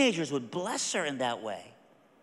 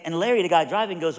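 ordinary talk

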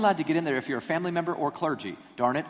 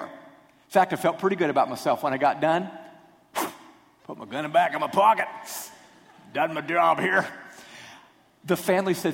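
normal talking voice

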